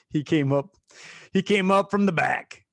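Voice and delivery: ordinary talk, nothing marked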